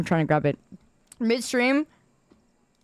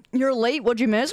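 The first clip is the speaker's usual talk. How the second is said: ordinary talk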